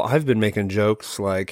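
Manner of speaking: usual speaking voice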